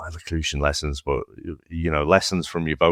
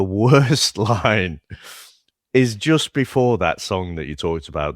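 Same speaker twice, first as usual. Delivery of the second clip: usual speaking voice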